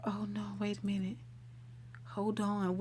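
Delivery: quick whispered aside; hushed self-correction, no voice. Real, not acted. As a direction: breathe it out under your breath, fast.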